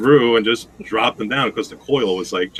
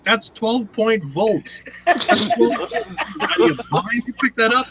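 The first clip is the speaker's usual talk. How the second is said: words broken up by real laughter; laughing; 13 semitones higher, slower than usual. Real, not acted. Telling the story of the twelve volt box, laughing through it. Laughing while retelling the scolding.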